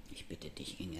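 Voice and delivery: low, soft, understated annoyance; quietly irked reproach; indignant. A narrator's reading. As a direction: object under your voice, a touch indignant. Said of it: "erwidere ich ungehalten".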